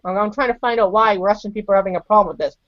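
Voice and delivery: ordinary delivery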